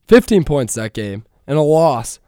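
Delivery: ordinary talk, nothing marked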